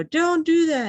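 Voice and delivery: high, loud yell; high-pitched shout of protest; yelled plea. Retold or imitated. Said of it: "I just yelled back at him".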